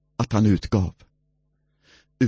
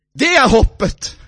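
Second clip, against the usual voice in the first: higher, louder shout of hope; preaching shout; 6 dB louder than usual. Acted. Preacher shouting to the congregation about hope. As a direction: cry out the hope.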